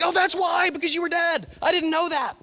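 high, amazed realisation; dawning surprise. Pretend. Viewer realising the twist at the end, amazed and high. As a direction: hand over the twist like a stunned moviegoer. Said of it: "And he's like".

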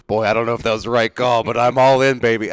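loud, hyped-up fan reenactment; retold excited yelling. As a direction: play the worked-up fan going all in. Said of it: "running around and just yelling in my house".

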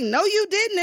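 high-pitched mock shock; acted-out scandalized reply; disbelief. Acts Whoopi's shocked reaction.

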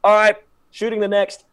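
imitating a brisk crew announcement; first assistant director. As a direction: call it out to the whole crew. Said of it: "first assistant director, he's just like".

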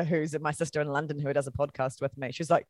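normal talking voice

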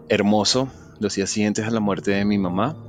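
plain reading voice

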